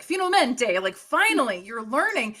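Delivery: finally impressed; pleased teasing shopkeeper; amazed butcher. Retold or imitated. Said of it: "He's like".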